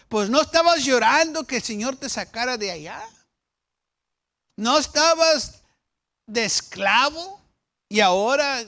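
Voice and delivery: loud, rising rebuke from the pulpit; scolding preaching. Acted. Preacher rebuking the people for wanting Egypt, loud and high. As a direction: confront them, voice climbing high.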